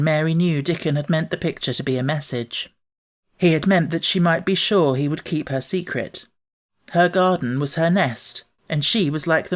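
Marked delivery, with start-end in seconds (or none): none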